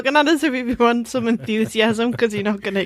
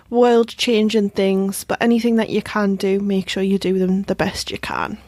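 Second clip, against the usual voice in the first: ordinary talk, nothing marked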